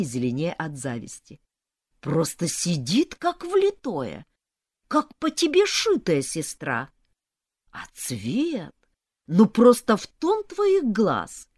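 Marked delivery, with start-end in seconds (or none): 2.0-11.5 slow, drawn-out cooing praise; gushing flattery. A narrator's reading. Sisters to mother in borrowed dress, gushing. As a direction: stretch the compliments, rising high in admiration.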